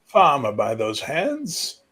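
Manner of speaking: put-on high, loud old king's voice. Old king summoning the young high king closer. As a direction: croak it out like an ancient ruler.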